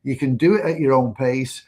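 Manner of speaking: normal talking voice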